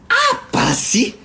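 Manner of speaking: very high, slow, drawn-out mock whine; mock sulky reply. Mocking the caller's sulky denial, high drawn-out whine. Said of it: "Kayak mereka tuh kayak langsung".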